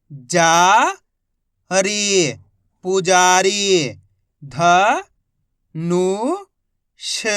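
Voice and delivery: each syllable called out slowly and loudly, then the whole word drawn out; teacher spelling each syllable aloud; syllable-by-syllable reading. Shown as a demonstration. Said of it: "मैं किस तरीके से एक एक अक्षर का उच्चारण करके इनको पढ़ रहा हूँ". (अक्षर-अक्षर ज़ोर से बोलकर पढ़ाना)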